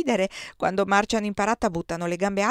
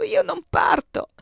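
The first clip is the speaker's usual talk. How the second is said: much higher, slow, stressed "io"; desperate refusal; 11 semitones higher, 6 dB louder, slower than usual. Acted. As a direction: dig in with "io non parto", almost crying out. Giulia refuses to leave.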